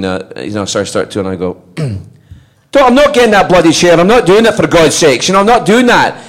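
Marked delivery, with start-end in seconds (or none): 1.8-6.2 high, loud Scottish protest; performed Scottish outburst, pitched up and insistent; Scottish accent. Acted. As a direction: burst out indignantly in a Scottish accent. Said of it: "I'm gonna do it Scottish".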